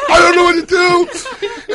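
high, loud, drawn-out helpless cry; mock panicked wail. Host imitating the cornered woman, panicked wail. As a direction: wail it in exaggerated despair.

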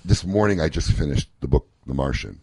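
usual speaking voice

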